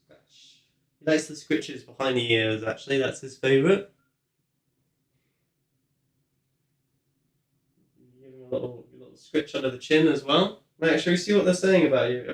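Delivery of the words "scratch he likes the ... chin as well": softer, tender petting talk; soft cooing to a cat. Real, not acted. Owner coos softly to her cat while petting. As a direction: coo gently while stroking him.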